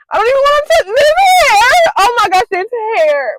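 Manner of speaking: high, loud panicky wail; whimpering, near tears; panicked whine. Real, not acted. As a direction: squeal it out in real disgust. Panicking touching tarantula hair.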